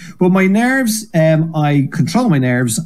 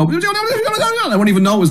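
wound-up self; slower than usual. Retold or imitated. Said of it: "I'll just go on stage and I go".

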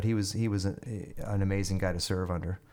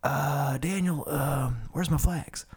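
colonel's voice; 6 semitones higher, slower than usual. Retold or imitated. Colonel asking about flags.

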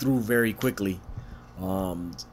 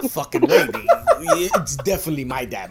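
higher and louder, laughing through it; laughing boast; 3 semitones higher, 5 dB louder than usual. Retold or imitated. Boasting to Milky about his dad, laughing. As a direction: brag like a kid, barely holding it.

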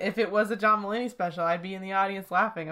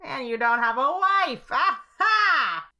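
rising to a high, louder gloating Aha; mock gloating taunt; 5 semitones higher, 5 dB louder, slower than usual. Imagined rival taunting the host at his window, mock gloat. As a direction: crow over him like a cartoon villain. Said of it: "he's like".

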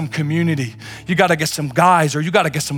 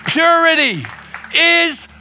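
preaching at full force; 11 semitones higher, 4 dB louder than usual. Acted. Preacher declaring freedom to the congregation, full force. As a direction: proclaim it to the whole room.

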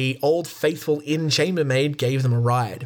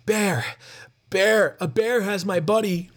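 raised-pitch alarm call without much volume; contained yell; 6 semitones higher than usual. A narrator's reading. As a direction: narrator acting out a yelled warning.

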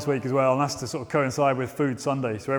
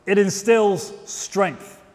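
slow emphasis; 3 semitones higher, much slower than usual. Shown as a demonstration. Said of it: "three things within us".

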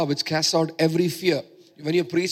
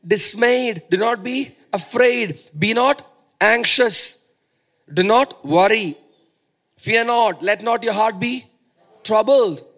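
slow, forceful commands, raised in pitch and volume; emphatic scripture recitation; 4 semitones higher, 5 dB louder, slower than usual. Acted. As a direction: declaim each command deliberately, pausing between them.

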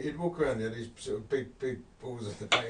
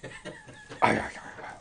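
high unvoiced wheezing snicker; wheezy cartoon-dog snicker. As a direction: snicker like Muttley. Muttley snicker after dog voice.

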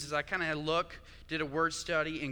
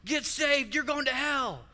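much higher and louder mock street-preacher yell; hammed-up hellfire shouting; 10 semitones higher, 5 dB louder than usual. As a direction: bellow it at passersby like a corner preacher.

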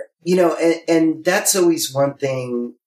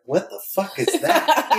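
laughing disbelief; 12 semitones higher than usual. Retold or imitated. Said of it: "It's like".

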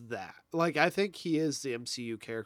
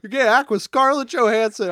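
loud, higher quote; hammed-up call from the director; mock director voice; 7 semitones higher, 11 dB louder than usual. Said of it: "he's like".

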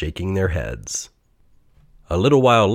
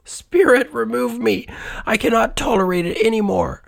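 very high, desperate begging; anguished plea. A narrator's reading. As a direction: beg the spirit to take him away. Scrooge begs spirit in anguish.

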